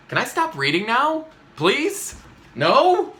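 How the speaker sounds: mock desperate pleading; pleading whine. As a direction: beg like a kid who wants out. Reader begging to stop.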